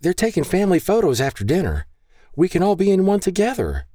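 high-pitched, eager plea; hopeful proposal. A narrator's reading. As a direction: pitch it high and hopeful to win her over. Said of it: "with a renewed sense of hope".

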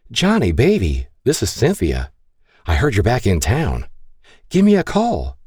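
sultry answering-machine message. Acted. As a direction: purr it like a sultry invitation. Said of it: "a sultry voice came over the speaker".

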